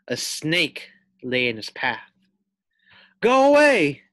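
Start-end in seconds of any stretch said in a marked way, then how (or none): 3.2-4.0 high, loud command; fierce threat. Acted. Pig threatens a snake, loud fierce command. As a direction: chase off the snake. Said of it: "thought Fluffy".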